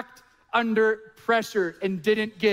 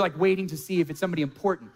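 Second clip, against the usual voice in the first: ordinary talk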